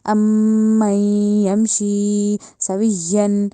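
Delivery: अम nasal and सवियन with doubled ya, chanted; slow recitation. Shown as a demonstration. Teacher recites the verse for students, slow nasal chant. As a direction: hum अम and lean on सवियन, very slow. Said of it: "इसकी या को अपने पीछे वाले वाव से मिलाना है".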